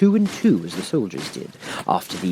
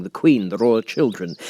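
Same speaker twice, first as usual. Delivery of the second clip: plain reading voice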